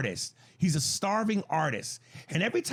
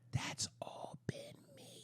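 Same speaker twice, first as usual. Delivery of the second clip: sudden breathy whisper, much softer; 18 dB quieter, slower than usual. Real, not acted. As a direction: drop to a secret whisper.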